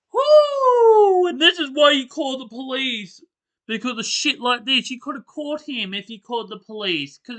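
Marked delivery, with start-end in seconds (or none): 0.1-1.3 shaky woo. Real, not acted. Shaken by the scary story.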